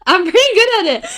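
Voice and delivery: put-on Southern Belle accent. Sister showing off her Southern Belle accent. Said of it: "I got a really good Southern accent".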